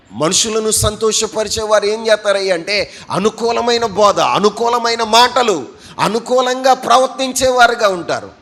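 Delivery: loud, high preaching build; fervent sermon voice; preaching at full force. Real, not acted. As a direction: drive the point home to the church. Preacher raises voice.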